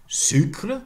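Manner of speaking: SUCRE with a French u and r; French pronunciation. Shown as a demonstration. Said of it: "French, sucre".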